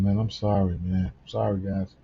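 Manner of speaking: ordinary talk, nothing marked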